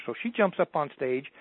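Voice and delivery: normal talking voice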